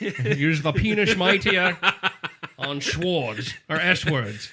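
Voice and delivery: sketch-comedy impression, slushy S sounds; slow mock-pompous actor, breaking into laughter; Sean Connery. Host quotes SNL sketch to co-host, Sean Connery impression. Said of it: "Thank you, Daryl Hammond".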